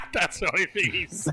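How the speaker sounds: real laughter breaking through the words; laughing. Real, not acted. Game master amused by player's only remark, laughing.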